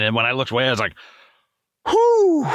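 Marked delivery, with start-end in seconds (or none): 1.9-2.4 high, loud "whew" of release; relieved whew. Retold or imitated. Looking away after staring without blinking, relieved whew. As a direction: blow out a big acted breath of relief. Exhaling after unblinking stare.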